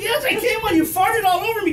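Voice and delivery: very high, loud defensive whine; mock panicked yell. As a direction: squeal it in comic panic. Answering wife in panic.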